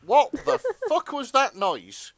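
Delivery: cracking up in disbelief; laughing disbelief. Real, not acted. Cohost stunned by a weird noise, laughing.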